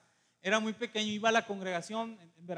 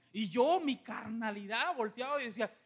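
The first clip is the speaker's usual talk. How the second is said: ordinary talk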